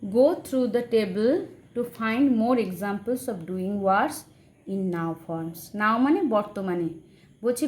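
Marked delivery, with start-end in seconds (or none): 0.0-5.8 slow, carefully spaced read-aloud. Shown as a demonstration. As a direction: read it slowly for the class. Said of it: "teacher reads textbook line aloud". (ধীরে ধীরে পড়ে শোনানো)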